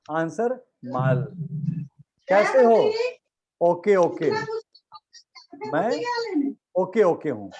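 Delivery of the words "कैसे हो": mock question. Teacher voicing a question.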